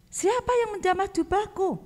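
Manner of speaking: high, searching voice of Jesus; Jesus asking. Acted. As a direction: ask the crowd who touched you. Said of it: "Lalu Tuhan Yesus bertanya". (suara Yesus bertanya, tinggi)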